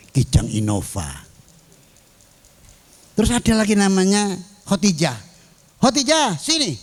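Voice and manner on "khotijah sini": high, carrying call across the room; calling out a name. Retold or imitated. Teacher calls student Khotijah. (ustadz memanggil Khotijah dengan suara tinggi)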